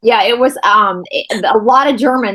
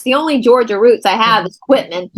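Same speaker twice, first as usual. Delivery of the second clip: ordinary delivery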